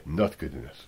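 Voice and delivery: low, quiet, deadpan verdict; glum team boss. Retold or imitated. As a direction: drop it low and dour. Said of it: "what he said, yeah".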